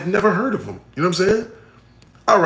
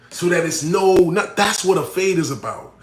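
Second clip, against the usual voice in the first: ordinary delivery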